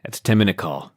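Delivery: low, soft, matter-of-fact call; calmly understated, voice dropped low; calm announcement. Retold or imitated. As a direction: imitate an unruffled, quiet announcement. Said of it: "they just calmly say".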